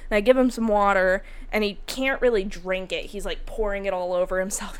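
usual speaking voice

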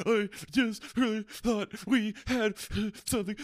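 very high, loud hammed-up wail; mock drunk crying. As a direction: whine it like a heartbroken drunk. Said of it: "And I'm like".

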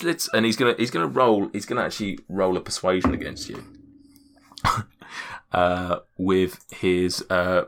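ordinary delivery

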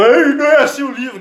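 high, loud mock complaint with laughter; mock objector. Imagined readers objecting to his street-style retelling, high mock protest. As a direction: jump up high and loud, imitating indignant critics.

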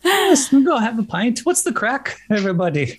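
high-pitched, hammed-up Irish; mock Irish accent. Inviting everybody for a pint, mock Irish accent. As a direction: play a jolly pub-goer with an Irish accent. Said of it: "it was really Irish".

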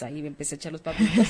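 rushed words dissolving into a laugh. Real, not acted. Telling friends her funny habit, laughing. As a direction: laugh it out fast as you tell it.

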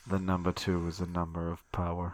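serial killer voice. Acted. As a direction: preach it like a creepy cult leader. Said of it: "I will not do that voice again".

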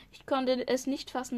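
usual speaking voice

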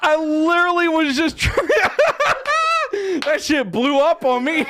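high-pitched words breaking into laughter; helpless laughter. Real, not acted. Blindsided by his own joke, helplessly laughing. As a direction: try to talk through a laughing fit. Still cracking up.

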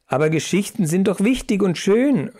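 plain reading voice

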